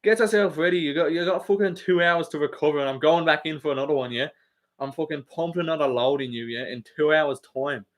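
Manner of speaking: put-on cocky Irish fighter accent; Conor's Irish accent. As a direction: play a brash Irishman laying down plans. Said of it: "that's what he's saying".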